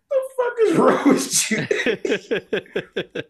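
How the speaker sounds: high, very slow drawn-out disbelief; mock disbelief. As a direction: stretch each word out, baffled. Retelling reaction.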